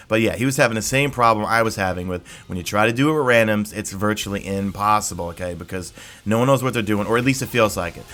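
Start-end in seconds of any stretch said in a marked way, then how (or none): none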